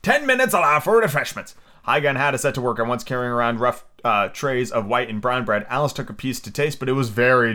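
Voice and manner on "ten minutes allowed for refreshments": high royal announcement; calling out. A narrator's reading. King announcing a break in the fight, high call. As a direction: call it out over the crowd. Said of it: "the king called out".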